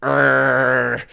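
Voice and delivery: hammed-up mock chef's cartoon grumble; a chef reduced to a silly growl; Gordon Ramsay fuming. Podcaster imagining the chef judging food like himself, mock grumble. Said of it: "he's like".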